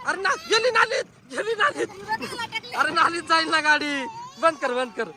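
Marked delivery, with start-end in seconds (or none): 0.1-1.9 a real alarmed cry, higher, as the scooter rolls into the drain. Real, not acted. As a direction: cry out in dismay, voice breaking.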